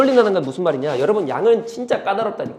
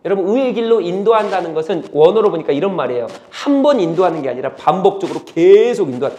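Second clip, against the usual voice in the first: ordinary talk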